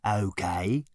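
lightly voiced glum bird; a touch of sullen muttering; morose mutter. A narrator's reading. Bird answering Zaphod, muttered morosely. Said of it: "muttered the bird morosely".

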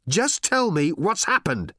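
high, slow, insistent order; exasperated demand. A narrator's reading. Ford pressing the robot for answers, exasperated. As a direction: press each word with exasperation.

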